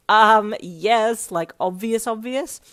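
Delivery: loud, emphatic teasing; amused outburst. A narrator's reading. Friend bursting out at his staring, amused and teasing. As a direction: drag out the um, teasing hard. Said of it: "You burst out laughing".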